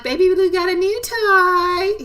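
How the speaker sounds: put-on high, slow baby-talk parrot voice; baby bird voice. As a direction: coo it like a tiny parrot copying words. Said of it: "He talks like a little baby".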